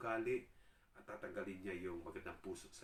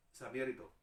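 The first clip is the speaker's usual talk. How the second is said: usual speaking voice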